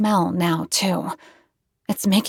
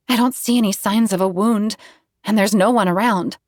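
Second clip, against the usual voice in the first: ordinary delivery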